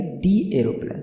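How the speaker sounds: "दी" said dee before "aeroplane"; long ee in "दी", leading into "aeroplane". Shown as a demonstration. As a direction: say "दी" as dee, not duh. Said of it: "उसको दी पढ़ेंगे".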